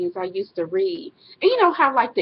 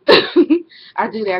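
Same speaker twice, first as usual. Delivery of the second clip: laughing admission; 10 dB louder, much slower than usual. Real, not acted. Laughing admission of silent treatment.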